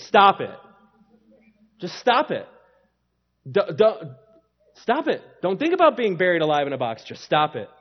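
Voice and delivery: loud, blunt stop it; stern counselor snapping stop it; Bob Newhart stop it. Retold or imitated. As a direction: bark the order to stop it flat. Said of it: "Bob Newhart sketch".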